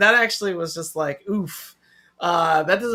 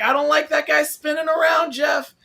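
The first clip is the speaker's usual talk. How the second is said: loud high mock gripe; half-joking shouted complaint; 8 semitones higher, 4 dB louder than usual. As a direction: yell it like a playful whine at a friend. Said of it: "and I was like".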